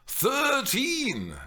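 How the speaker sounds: high, drawn-out disbelief; can't believe it; incredulous. Real, not acted. Mimi echoing Juliet's age in disbelief, high. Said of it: "Mimi repeated incredulously".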